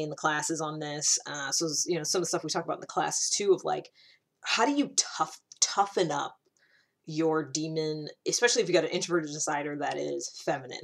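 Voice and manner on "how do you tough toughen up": ordinary talk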